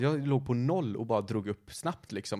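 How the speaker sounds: normal talking voice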